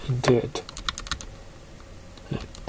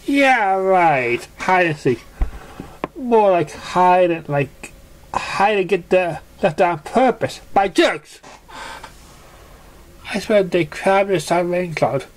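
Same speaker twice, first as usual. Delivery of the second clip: high, bitter sarcastic pony rant; 7 semitones higher than usual. Acted. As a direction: scoff at the whole game. Narrator voices Surprise.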